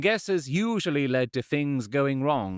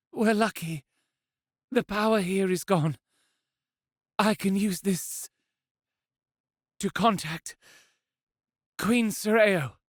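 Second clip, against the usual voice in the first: lighter, higher woman's voice, weak but determined; resolute injured woman; 9 semitones higher than usual. Acted. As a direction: speak through pain but stay firm.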